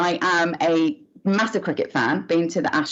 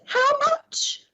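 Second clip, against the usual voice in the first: mock shocked regulator. Regulator punchline quote.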